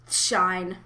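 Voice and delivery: normal talking voice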